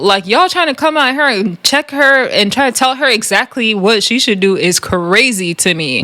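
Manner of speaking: usual speaking voice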